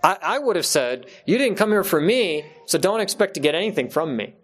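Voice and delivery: usual speaking voice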